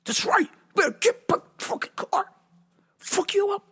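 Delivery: mock taunting. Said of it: "I was like".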